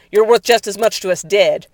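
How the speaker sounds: hard shout at a fugitive; shouted threat. A narrator's reading. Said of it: "shouted Cody".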